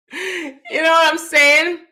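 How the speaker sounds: said through loud high laughter; can barely get it out for laughing. Real, not acted. Still laughing at copycat looks.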